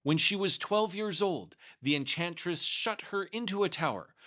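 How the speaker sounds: ordinary delivery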